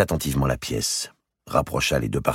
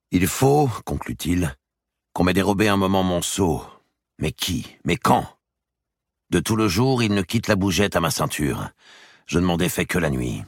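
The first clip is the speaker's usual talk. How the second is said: a bit higher, pleading to be believed; earnest king; 6 semitones higher than usual. Acted. The king begging his brother to believe him, earnest. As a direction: insist you knew nothing.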